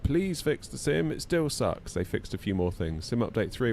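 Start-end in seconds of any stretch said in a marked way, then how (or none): 0.0-1.9 slow, higher whiny plea; pleading. Retold or imitated. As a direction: whine it like a tired fan. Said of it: "we're like".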